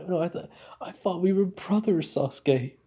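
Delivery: hammed-up tearful reproach; mock tearful. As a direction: fake being heartbroken by a friend. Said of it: "he was just pouring through tears".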